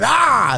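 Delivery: sudden high "ah!"; playful knife-lunge yell; mock attack yell. Demonstrates menacing with a knife.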